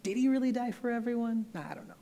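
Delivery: high, sly tempter voice; a hammed-up serpent sowing doubt; mock serpent voice. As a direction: whisper doubt like the snake in the garden. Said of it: "the serpent has been distorting God's voice and saying".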